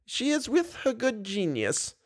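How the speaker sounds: put-on high, soft, serene old lady; calm as if it were obvious; calmly. A narrator's reading. Old lady calmly answers Raoul, high and soft. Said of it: "the old lady replied calmly".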